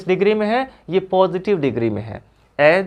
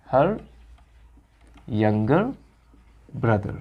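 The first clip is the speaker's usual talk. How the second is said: slow, word by word with pauses, lower and softer; slow dictation; 6 semitones lower, 4 dB quieter, much slower than usual. Shown as a demonstration. Teacher dictating the words for students to write down. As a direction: spell it out word by word for the class. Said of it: "यहाँ पे आप लिख सकते हैं her younger brother".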